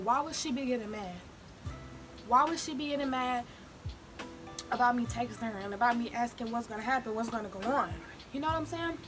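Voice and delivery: ordinary delivery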